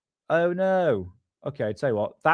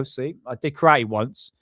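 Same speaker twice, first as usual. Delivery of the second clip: ordinary delivery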